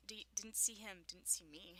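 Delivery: ordinary talk, nothing marked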